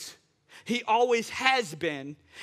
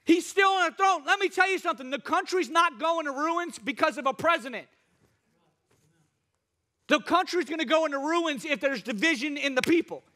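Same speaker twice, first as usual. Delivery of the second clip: raised-pitch sermon delivery; impassioned preacher's proclamation; fervent preaching; 6 semitones higher than usual. Real, not acted. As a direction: drive the point home to the congregation. Preaches to congregation.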